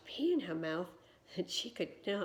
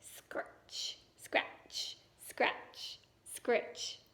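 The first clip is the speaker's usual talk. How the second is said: scratching noise; 5 semitones higher, much slower than usual. Acted. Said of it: "he heard the noise of a hoe".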